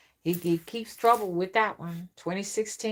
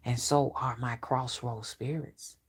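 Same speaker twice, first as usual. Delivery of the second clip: ordinary talk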